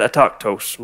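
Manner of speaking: "Autochthos" said low and dropping, with disdain. Shown as a demonstration. Pastor teaching congregation the word, with disdain. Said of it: "Can you say it like that?".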